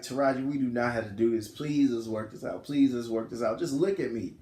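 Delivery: low, soft, earnest plea; acted-out subdued appeal; retold quiet pleading. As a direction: beg quietly as the husband. Husband pleads against the divorce.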